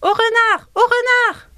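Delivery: very high, loud alarm shout; mother crow's alarm cry. Acted. Mother crow warns everyone of the fox with a very high, loud shout. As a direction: sound the alarm like a panicked mother bird. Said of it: "Et qu'est-ce que la maman de Gilles crie?".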